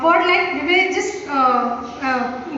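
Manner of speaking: ordinary delivery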